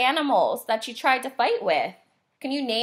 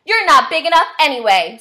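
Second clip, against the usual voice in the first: high, loud, bossy little bug voice; snooty tiny bug, sneering and loud; grouchy ladybug; 5 semitones higher, 10 dB louder than usual. A narrator's reading. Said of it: "said the grouchy ladybug".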